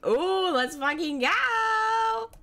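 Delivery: high, drawn-out celebration; hyped cheer. Real, not acted. Gamer celebrating progress, high stretched hyped cheer. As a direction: whoop it, stretching the last word upward.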